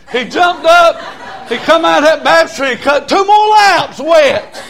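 loud, high revival storytelling; fired-up country preacher; full-force preaching. Real, not acted. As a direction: holler the tale out, pitched high.

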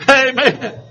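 usual speaking voice

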